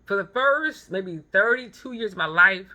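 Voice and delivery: ordinary talk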